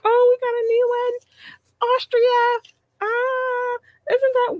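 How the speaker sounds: very high, excited squeal; squealing with delight. Retold or imitated. Podcaster imitating her own thrill at a new listener country, very high squeal. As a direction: gush like a thrilled fan.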